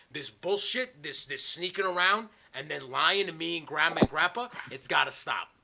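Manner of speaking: loud re-enacted lecture; letting him have it. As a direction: act out scolding a misbehaving kid. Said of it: "I just let him have it".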